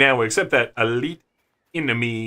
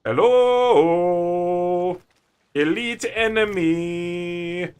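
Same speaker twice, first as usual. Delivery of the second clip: sing-song greeting; 4 semitones higher, much slower than usual. Pretend. Calling out to enemy.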